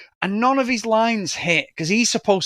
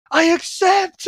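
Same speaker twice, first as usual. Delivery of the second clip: high mock accept; 10 semitones higher, 3 dB louder, slower than usual. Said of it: "she goes".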